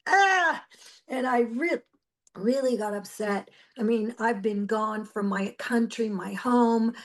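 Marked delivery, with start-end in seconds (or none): 0.1-0.6 high, loud crying wail; anguished cry. Retold or imitated. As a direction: let out a pained, tearful cry. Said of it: "And I'm like".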